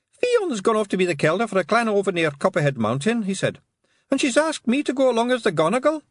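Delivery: high, bright little Scots pixie; a tiny Scots warrior reporting; Scottish pixie voice. A narrator's reading.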